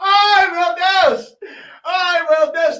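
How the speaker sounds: real laughter breaking into a loud wail; laughing. Real, not acted. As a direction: howl with disbelieving laughter. Laughing in disbelief at penalty.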